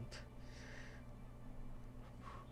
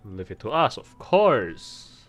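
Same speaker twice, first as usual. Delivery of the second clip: read-aloud NPC boast; 5 dB louder than usual. Acted. Reading NPC lines aloud.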